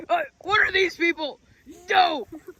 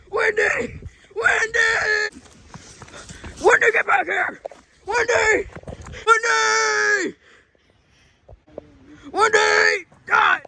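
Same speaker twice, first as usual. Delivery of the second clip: calling out; 7 semitones higher, much slower than usual. Acted. Father calling his lost daughter back, desperate shout. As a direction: shout her name into the distance.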